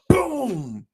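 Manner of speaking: loud high shouted boom; mock slam sound; imitated door slam. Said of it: "slam the door".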